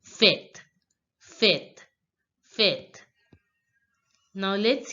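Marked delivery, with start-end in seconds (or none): 0.0-3.0 "fête" as a short "fett"; French pronunciation. Shown as a demonstration. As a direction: repeat "fête" with open e and sounded T. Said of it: "pronunciation of this word party is".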